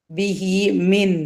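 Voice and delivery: ബിഹി മിൻ with the ഹി held two counts, slow; lengthened vowel. Shown as a demonstration. Teacher showing students the two-count stretch. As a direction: draw out ബിഹി before മിൻ. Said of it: "നീട്ടിയിട്ടാണ് പിന്നെ മീമും pronounce ചെയ്യുക".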